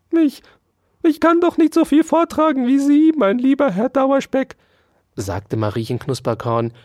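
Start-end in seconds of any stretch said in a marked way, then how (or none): 0.1-4.5 very high, loud squeaky protest; plaintive mouse. A narrator's reading. Mouse excuses itself to lieber Herr Dauerspeck, whining.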